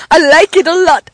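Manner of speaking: loud, high movie-quote voice; hammed-up comic film catchphrase; Dumb and Dumber impression. Quoting Dumb and Dumber to co-host, high and loud. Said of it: "when he says that in Dumb and Dumber".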